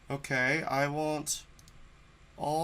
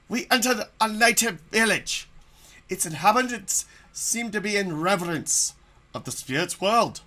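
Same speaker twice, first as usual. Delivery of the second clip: story narration; 6 semitones higher, 5 dB louder than usual. Acted. Reads story text as narrator.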